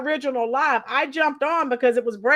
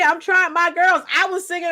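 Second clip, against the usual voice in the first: ordinary talk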